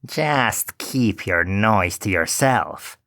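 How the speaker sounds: slow, dismissive witch voice; lightly voiced heartless witch; cold old witch. A narrator's reading. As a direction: hush the weeping girl coldly. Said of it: "said the old woman".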